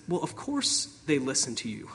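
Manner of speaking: soft, patient reassurance; gentle. Acted. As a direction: say it softly, as a patient teacher would. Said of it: "So he gently says".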